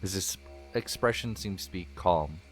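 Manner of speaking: soft, calm scene-setting narration; story narration. Acted. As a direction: paint the campfire scene gently.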